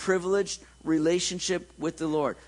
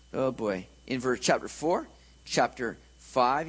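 normal talking voice

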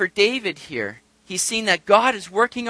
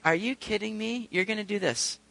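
muted disbelief; 2 semitones higher, 9 dB quieter than usual. Retold or imitated. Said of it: "he just sat there very smug. I'm just like".

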